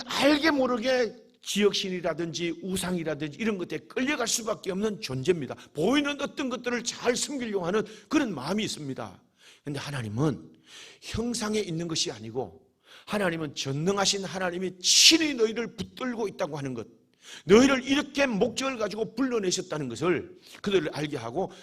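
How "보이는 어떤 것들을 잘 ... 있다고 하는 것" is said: usual speaking voice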